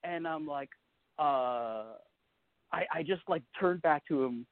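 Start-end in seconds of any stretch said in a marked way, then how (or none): none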